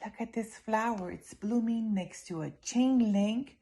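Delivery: usual speaking voice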